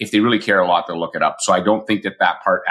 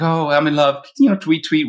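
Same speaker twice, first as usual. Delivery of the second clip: high, dreamy mock lovestruck voice; mock-swoon in a raised pitch; lovestruck client; 8 semitones higher than usual. Client gushing about her new boyfriend, mock lovestruck.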